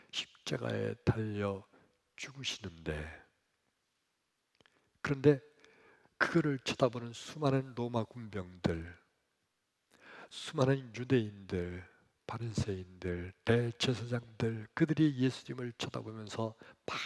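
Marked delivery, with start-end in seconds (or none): none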